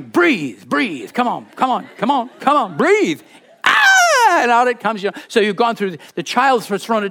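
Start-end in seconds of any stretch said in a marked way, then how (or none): 0.0-3.2 urgent coaxing, rising higher to the end; a parent pleading with a breathless baby. Retold or imitated. Parent urging a silent-crying baby to breathe, coaxing. 3.6-4.4 put-on sudden high wailing baby cry, loud; imitated baby wail. Preacher imitates a baby's cry bursting out, loud wail.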